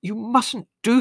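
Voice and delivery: high, slow scolding mother; trembling mother reprimanding her son; mother's reprimand. A narrator's reading. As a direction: scold your son, shaken. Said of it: "reprimanded Leslie severely".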